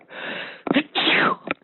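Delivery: a real sneeze; sneezing. Real, not acted. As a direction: sneeze right into the mic. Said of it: "excuse me, I'm gonna sneeze".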